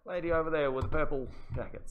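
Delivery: bang the hammer and announce the winner; auctioneer's call. Pretend. Mock auctioneer closing a sale.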